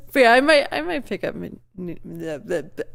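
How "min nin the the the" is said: stumbling over repeated syllables; tongue-tied. Real, not acted. Streamer attempting a game title, tongue-tied. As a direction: trip over the start of the name. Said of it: "I cannot say Teenage Mutant Ninja Turtles".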